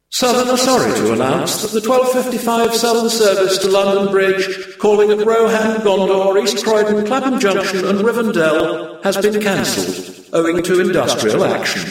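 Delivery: high, sing-song platform announcer; a rail announcer reading cancellations; station announcement. A narrator's reading. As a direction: read it like a tannoy announcement.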